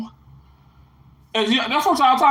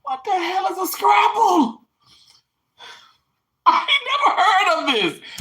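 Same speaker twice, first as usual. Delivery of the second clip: higher, laughing disbelief; incredulous; 10 semitones higher than usual. Real, not acted. To the viewers about scrapple, laughing disbelief.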